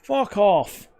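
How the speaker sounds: angry outburst. Real, not acted. Fan at a conceded goal, a real angry yell. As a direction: spit it out in real disgust.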